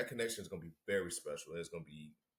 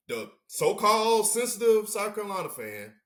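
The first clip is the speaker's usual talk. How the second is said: mocking voice; 12 semitones higher, 10 dB louder than usual. Mocking a type of fan.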